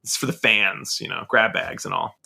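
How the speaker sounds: usual speaking voice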